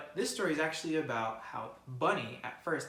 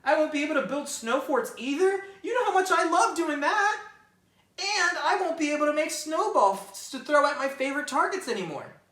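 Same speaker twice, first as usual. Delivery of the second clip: high, louder, building whiny rabbit; complaining rabbit; 13 semitones higher, 11 dB louder than usual. Acted. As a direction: pout over every lost snow game.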